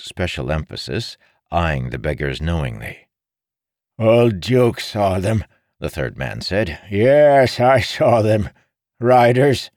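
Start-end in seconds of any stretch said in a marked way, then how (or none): 4.0-5.4 put-on slow, high, creaky old beggar. A narrator's reading. Old beggar boasting he saw the riders. 6.9-9.7 high, loud, eager beggar; excited old beggar. A narrator's reading. Old beggar eagerly insisting to Marin.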